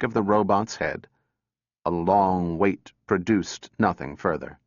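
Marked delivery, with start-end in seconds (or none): none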